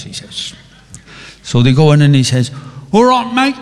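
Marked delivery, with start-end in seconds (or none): 2.9-3.6 high, hammed-up Cockney greeting; an Irishman faking a Cockney accent; mock Cockney. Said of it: "you can do that Cockney bit".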